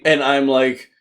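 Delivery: ordinary delivery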